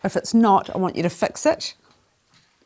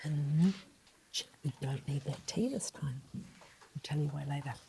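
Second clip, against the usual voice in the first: much softer, hushed sound-by-sound talk; teacher softly sounding out pinched; soft phonics; 6 semitones lower, 16 dB quieter than usual. Shown as a demonstration. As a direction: hush down and sound it out. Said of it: "whispered phonics".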